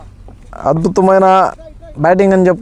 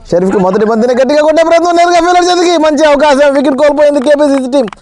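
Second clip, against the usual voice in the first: much higher, rising to the catch; excited wicket call; 6 semitones higher than usual. Real, not acted. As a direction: build up to the wicket. Wicket falls, caught.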